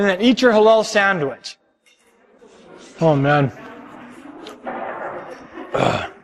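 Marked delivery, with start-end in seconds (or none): 3.0-6.1 low groan from the horseradish burn; after eating horseradish. Real, not acted. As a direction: groan through the sting. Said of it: "that horseradish is rocking my world".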